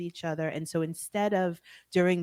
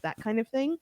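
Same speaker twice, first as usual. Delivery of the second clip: ordinary talk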